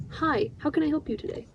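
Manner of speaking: polite helpful service voice; chirpy phone helpdesk greeting; customer service voice. Shown as a demonstration. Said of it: "it's the voice of".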